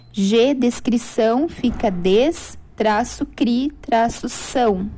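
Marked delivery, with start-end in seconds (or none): 1.5-4.9 teacher spelling out syllables to students. Shown as a demonstration. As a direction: dictate re, a, pro, vei, to slowly with pauses. (ditando as sílabas devagar)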